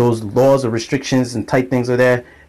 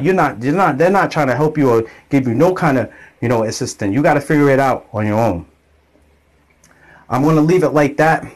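usual speaking voice

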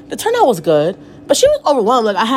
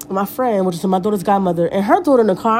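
ordinary talk

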